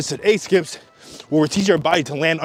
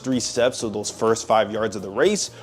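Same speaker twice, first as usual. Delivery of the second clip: voiceover narration. Acted.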